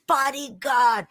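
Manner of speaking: high, loud overjoyed cry; tiny hero thrilled to be named bodyguard; thrilled exclamation. Acted. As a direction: burst out with disbelieving joy. Hero thrilled at new title.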